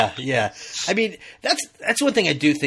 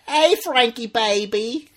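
higher, hammed-up New York accent; badly faked New York accent, raised pitch; 10 semitones higher, 4 dB louder, slower than usual. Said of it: "in this thick, like, New York accent".

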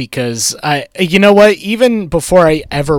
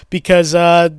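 ordinary talk, nothing marked

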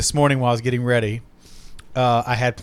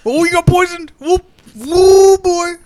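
much higher, louder, over-the-top mock alarm; hammed-up mock drama; 11 semitones higher, 8 dB louder than usual. Mocking the show's poison trope, hammed-up. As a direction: ham it up like a soap opera. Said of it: "so it's like".

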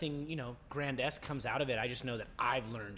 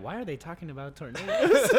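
baffled imitation; 4 semitones higher, 9 dB louder than usual. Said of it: "Krista over here like".

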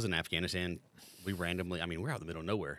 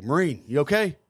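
lightly voiced sergeant calling out; sergeant major; 5 semitones higher, 9 dB louder than usual. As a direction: imitate an officer checking on a Marine, louder and higher. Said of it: "I hear the Sergeant Major say".

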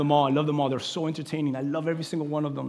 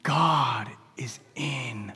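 very slow, fading into a whisper; 5 semitones lower, 5 dB quieter, much slower than usual. Acted. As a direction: let it sink to a whisper. Slow hushed sermon point.

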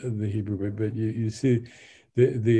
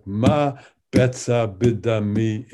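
"Ma betza bidami" read louder, beat by beat; Hebrew in three beats; 4 dB louder than usual. Shown as a demonstration. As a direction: give the Hebrew its three stresses. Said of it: "just exactly like".

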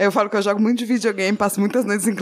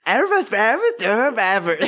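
ERVAS repeated as mock foreign invective; menacing intonation demo; slower than usual. Shown as a demonstration. Showing that something bad is coming out, exaggerated intonation on "ervas". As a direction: chant "ervas" with exaggerated rising, then falling tune. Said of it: "tem um lance de entonação".

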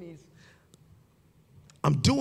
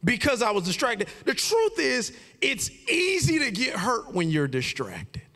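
preaching; 8 semitones higher than usual. Real, not acted. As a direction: drive the point home to the congregation. Preaching the lesson at full force.

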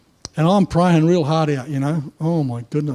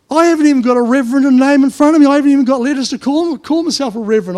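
fast, high and louder; excited protest; 8 semitones higher, 7 dB louder, faster than usual. Real, not acted. Pastor telling the congregation, fast and raised. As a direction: rattle it off in amused disbelief. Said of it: "excited protest".

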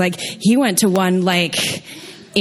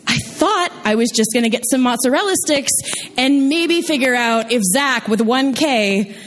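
usual speaking voice

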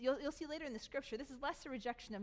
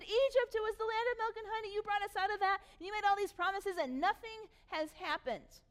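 mocking complainers; 8 semitones higher, 4 dB louder than usual. Mocking the grumbling Israelites.

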